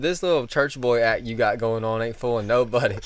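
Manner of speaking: put-on loud country-accented ribbing. Customer mocks clerk's innocent act, country accent. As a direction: tease him hard in a country accent. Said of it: "He's got a real country accent".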